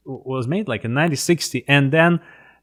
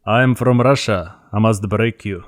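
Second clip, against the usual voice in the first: thick Russian accent; 3 semitones lower than usual. Shown as a demonstration. Actor showing how hard it is to go back to it, thick Russian accent. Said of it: "the thick Russian accent".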